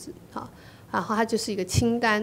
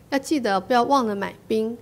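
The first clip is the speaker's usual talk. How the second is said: usual speaking voice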